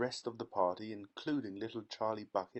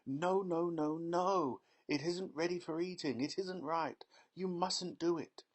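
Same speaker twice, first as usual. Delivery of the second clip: higher, pleading candy-maker; wringing-hands protest; 7 semitones higher than usual. A narrator's reading. Factory owner begging girl to stop, high pleading. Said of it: "wringing his hands and saying".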